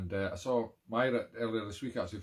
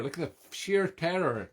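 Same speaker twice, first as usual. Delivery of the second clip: normal talking voice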